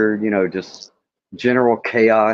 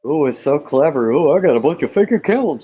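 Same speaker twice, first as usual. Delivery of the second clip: sarcastic mockery; 12 semitones higher, 6 dB louder than usual. Mocking the troll's fake accounts.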